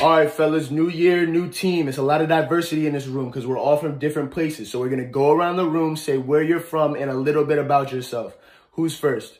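loud coach voice to the room; rally the new team like a coach; coach addressing team. Acted. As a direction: coach opening practice, loud and upbeat.

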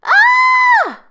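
piercing high scream; loud scream. A narrator's reading. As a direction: shriek at the top of the lungs. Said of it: "screamed so loudly".

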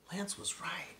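hushed whisper to herself; whispered realization while spying; secret whisper. Acted. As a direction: breathe it so nobody hears. Beauty secretly peeking inside.

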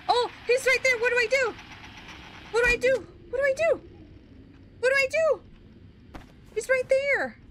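begging for help, voice way up; panicked. Real, not acted. Monster appears, player panics.

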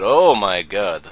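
put-on loud, slow, startled in the accent; shocked exclamation. Inspector shocked at the passport photo, loud. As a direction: react loud to the wrong face. Traveller doesn't match photo.

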